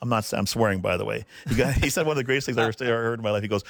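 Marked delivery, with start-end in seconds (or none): none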